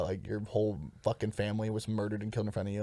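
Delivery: normal talking voice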